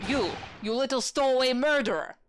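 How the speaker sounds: put-on accusing sailor in a thick Russian accent; gruff Russian sailor. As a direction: snap at the stowaway like a surly sailor. Sailor character shouts accusation.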